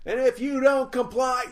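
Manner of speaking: loud, high fake British threat; mock British accent. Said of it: "Like she's all chim chimney?".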